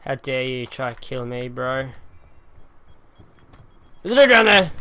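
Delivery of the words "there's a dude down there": callout. Real, not acted. Calling out an enemy.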